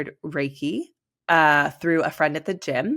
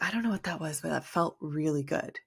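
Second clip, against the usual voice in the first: soft, dreamy murmur after the session; as if still half in a trance, softly; dazed and quiet; 8 dB quieter than usual. Retold or imitated. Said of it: "I ended up leaving and being like".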